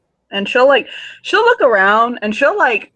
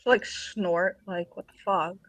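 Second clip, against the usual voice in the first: muttered scoff; 2 semitones lower, 11 dB quieter, slower than usual. Acted. Teen scoffing under breath.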